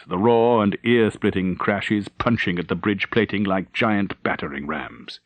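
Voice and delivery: ordinary delivery, nothing marked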